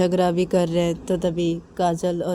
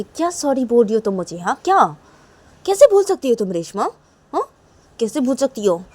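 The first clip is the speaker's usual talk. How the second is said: raised, higher-pitched demand; angry scolding; 5 semitones higher, 6 dB louder than usual. Acted. Kajal rejects Reshma's sorry, angry scolding. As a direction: fire indignant questions at her. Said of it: "ऐसे गुस्सा मत करो ना".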